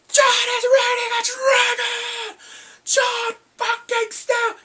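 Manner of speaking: high, drawn-out full-throated shout; excited scream. Acted. Fan reliving Jon mounting the dragon, excited scream. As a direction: scream it out in thrilled disbelief.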